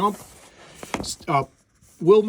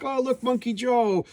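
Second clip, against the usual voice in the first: high, drawn-out singsong delight; mock delighted girl; 10 semitones higher, slower than usual. Squirrel girl spots her pet squirrel, mock singsong delight. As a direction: coo it high and slow like a thrilled kid.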